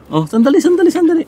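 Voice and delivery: very high coaxing to pets; sweet high wait-wait to the dogs. Real, not acted. Calming excited animals.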